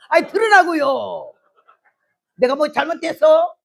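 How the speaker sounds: loud defensive. Retold or imitated. Wife defends her yelling.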